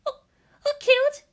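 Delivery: put-on a girl suddenly frightened for a friend; Hikari alarmed. Hikari hearing Toji's house is gone, high and worried. She faltered.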